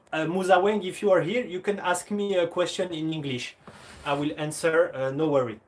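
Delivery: careful, halting English in a French accent. Real, not acted. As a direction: greet a foreign viewer in French-accented English. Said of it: "mon accent anglais incroyable".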